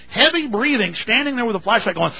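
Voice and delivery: imitating the officer panting like a wolf at our car window; imitated heavy breathing. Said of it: "she just kept heavy breathing".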